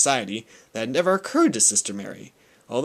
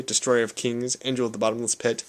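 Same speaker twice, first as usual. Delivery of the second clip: plain reading voice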